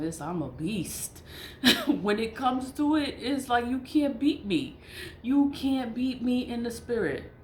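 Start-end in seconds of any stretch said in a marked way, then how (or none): 1.3-1.8 short unvoiced burst of laughter; a quick airy laugh at her own boast; breathy laugh. Real, not acted. Laughing to viewers about being a spiritual beast. 1.9-7.3 boastful excitement. Real, not acted. Boasting she can't be beaten.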